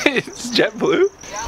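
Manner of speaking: slow incredulous rising question; can't believe it, rising; surprised question. Real, not acted. Surprised by the color name.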